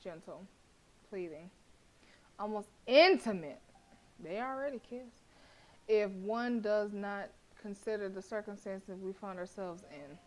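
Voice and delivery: measured narrator read; story narration. A narrator's reading. Narrator describing the tense silence, story voice. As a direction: set the scene like reading a novel.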